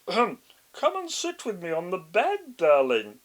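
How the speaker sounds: wolf faking a high, loud granny voice, hesitant; disguised voice. A narrator's reading. Wolf pretending to be grandma, clumsy disguised voice. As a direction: clear throat and fake an old lady badly.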